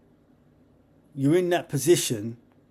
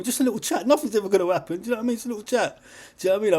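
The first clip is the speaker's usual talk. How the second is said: higher, playful coaxing; 8 semitones higher, much faster than usual. Acted. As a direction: reassure them teasingly, higher up. Coaxing listeners, high voice.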